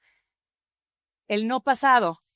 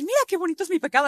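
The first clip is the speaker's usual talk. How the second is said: smug boast; 7 semitones higher, much faster than usual. Pretend. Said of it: "Y vas a decir".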